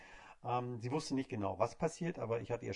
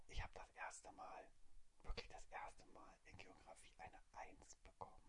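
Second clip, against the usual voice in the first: excited secret whisper; whispering on the phone; 5 semitones lower, 16 dB quieter than usual. Retold or imitated. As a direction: whisper proudly, hiding the call. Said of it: "warum flüsterst du so?". (stolzes Flüstern am Telefon)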